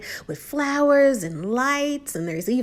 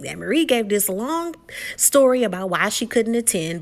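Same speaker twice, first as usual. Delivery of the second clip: ordinary delivery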